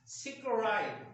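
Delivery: सिक्राइन said as sick-rine, stressed and slow; teacher sounding out सिक्राइन with long i-ai; correct pronunciation. Shown as a demonstration. Teacher showing students the right सिक्राइन. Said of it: "ये word होता है".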